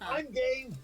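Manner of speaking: high, different put-on voice; Shivam's voice. Shivam answering the mayor, high put-on voice. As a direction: chirp it up high as the bird. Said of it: "and says".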